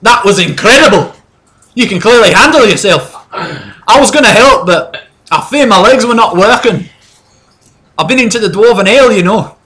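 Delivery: put-on loud, high, boisterous drunk dwarf; drunk dwarf mercenary. Dwarf mercenary praising the heroes, loud drunken voice. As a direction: bellow like a tipsy burly dwarf. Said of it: "he kind of staggers over and he goes".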